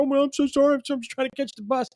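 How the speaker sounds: high, loud flustered apology that speeds up at the end; apologetic girl. Retold or imitated. Girl who bumped a stranger apologizing in a high hurry. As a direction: play a rushed girl blurting out sorry. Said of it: "she's like".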